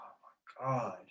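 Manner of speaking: breathless whispered oh my God; stunned whisper. Retold or imitated. Son frozen in shock before his mom, whispering. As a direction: barely get it out, frozen in shock.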